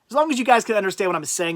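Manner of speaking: normal talking voice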